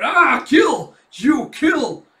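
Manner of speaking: high screaming monster war cry. Acted. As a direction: shriek the battle cry at full force. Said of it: "with a crawl war cry".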